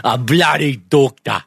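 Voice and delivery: hammed-up Cockney accent; mock British accent. Co-host jokingly declares brotherly love, in mock Cockney. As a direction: echo him in a put-on London accent. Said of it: "kind of British accent".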